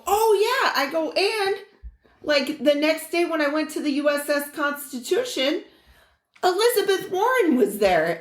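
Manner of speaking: high, eager retelling of own excitement; excited bragging. Retold or imitated. Telling the lady about the Constitution visit, excited. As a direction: pitch it up like thrilling news. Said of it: "I told her too, I go".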